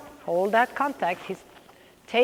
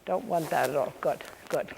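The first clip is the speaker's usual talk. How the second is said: normal talking voice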